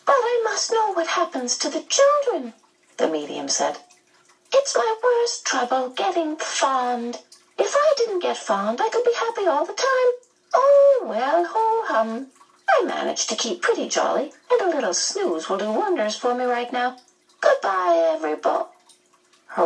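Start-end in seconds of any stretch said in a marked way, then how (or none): 0.0-2.5 very high, pleading medium voice; high-pitched, worried medium; anxious medium. A narrator's reading. 4.5-18.7 very high, fluting medium voice; high-pitched medium winding down for a snooze; fond, fretful medium. A narrator's reading.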